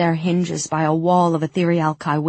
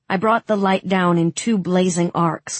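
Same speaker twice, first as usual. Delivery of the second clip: ordinary delivery, nothing marked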